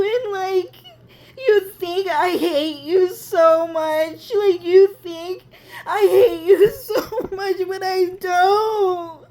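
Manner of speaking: high, drawn-out tearful wail; crying. Acted. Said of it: "I don't even know why I'm crying".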